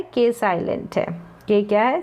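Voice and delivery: usual speaking voice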